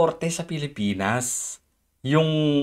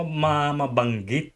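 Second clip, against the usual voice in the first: usual speaking voice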